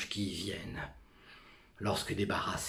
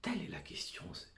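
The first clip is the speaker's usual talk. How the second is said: whispering; 8 semitones higher, 7 dB quieter, much faster than usual. Acted. Theatrical soliloquy, whispered opening.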